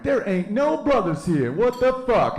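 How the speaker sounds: high, drawn-out outraged complaint; indignant movie character. Acted. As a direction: stretch it out in high-pitched mock outrage.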